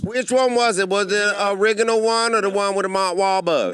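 sassy woman voice. Acted. S0 in character as woman.

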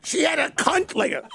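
very high, drawn-out comedian impression; hammed-up, squeezed-high celebrity impression; Gilbert Gottfried. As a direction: push a mocking impression way up high. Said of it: "He really talks like Gilbert Gottfried".

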